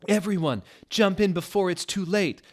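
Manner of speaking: urgent call. Acted.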